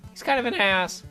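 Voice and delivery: normal talking voice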